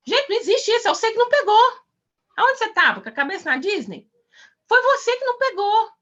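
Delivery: high-pitched incredulous reproach; incredulous scolding. Real, not acted. Scolds those who missed God's message. (bronca incrédula em voz aguda)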